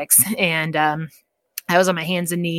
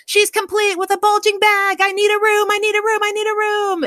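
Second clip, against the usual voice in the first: very high, louder mock hollering; nurse's urgent call; 4 dB louder than usual. Nurse calling down the ward for a room, urgent holler. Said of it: "all of a sudden she goes".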